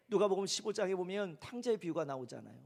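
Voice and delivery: ordinary talk, nothing marked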